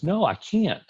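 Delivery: high, put-on voice of a fussy resident; stubborn resident. Resident refusing to examine the patient, mocked in a high voice.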